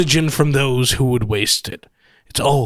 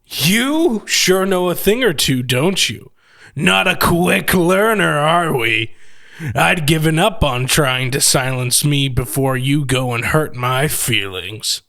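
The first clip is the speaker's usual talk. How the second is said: sarcastic; 9 dB louder, slower than usual. Acted. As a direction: needle the captive with mock admiration. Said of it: "I was met by a sarcastic tone".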